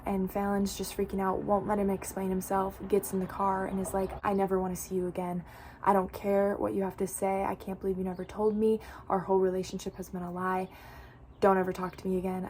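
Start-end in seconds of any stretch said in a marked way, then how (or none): 4.2-12.5 quick-fire breakup words, rushed out; rapid angry rant. Retold or imitated. Fallon in the car telling Ben never to call, fast mock-angry rant. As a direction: reel off the goodbye fast in mock fury.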